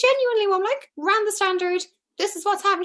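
high-pitched, insisting to an astonished mum; insistent reply. Retold or imitated. Acting out her reply to mother.

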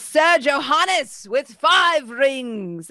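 slow, high announcer call. Acted. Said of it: "And he says".

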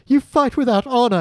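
high-pitched indignant protest; mock indignant. Podcaster mimics an affronted onlooker after a duel, mock indignant. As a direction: cry it out in mock outrage. Acting out a show character's accusation.

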